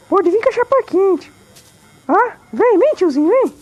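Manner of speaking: very high, loud cocky taunt; taunting put-on voice. Player taunting an enemy in the game, high and cocky. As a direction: goad the enemy to come at you.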